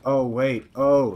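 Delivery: ordinary talk, nothing marked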